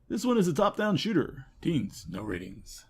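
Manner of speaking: normal talking voice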